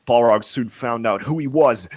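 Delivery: ordinary delivery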